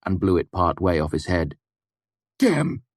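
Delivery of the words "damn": distracted curse. A narrator's reading. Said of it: "he said in a distracted voice".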